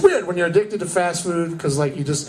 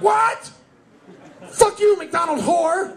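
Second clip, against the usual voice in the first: mock outraged yell; 13 semitones higher, slower than usual. Comic yelling at McDonald's drive-thru, outraged. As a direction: blow up at the cashier.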